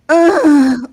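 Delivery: loud, high groaning exhale; mock the co-host's moan; exaggerated moaning breath; imitated moan. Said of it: "she was like".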